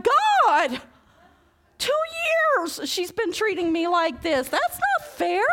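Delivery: high, loud whiny protest; indignant high protest; protesting cry. Retold or imitated. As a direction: complain to God like it's unfair. Protesting to God in story.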